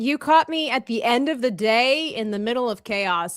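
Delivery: ordinary delivery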